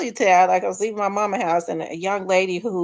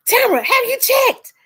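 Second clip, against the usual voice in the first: much higher, louder, eager question; excited friend; 7 dB louder than usual. Retold or imitated. A friend rushing to tell her the news, high and excited. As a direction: ask like you can't wait to tell.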